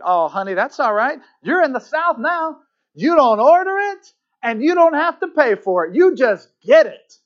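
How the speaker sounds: high, loud Southern waitress voice; very Southern accent. Acted. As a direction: reassure in a broad, sing-song Southern accent. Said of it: "in her very southern accent".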